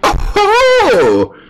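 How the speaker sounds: very high, drawn-out squeal of a jester. Acted. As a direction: beg like an excitable jester, way up high. Voices the jester.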